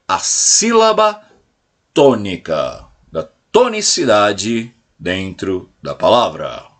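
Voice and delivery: grave e pausado, com formalidade didática; dê peso formal ao termo técnico; tom científico formal. Shown as a demonstration. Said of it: "científica de falar". (formal, devagar e grave)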